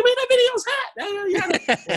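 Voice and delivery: high, drawn-out mock squeal of approval; hammed-up girlish enthusiasm; imitating excited teens. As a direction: stretch it out high, like a thrilled teenage fan. Mimics teenage girls' excited reaction.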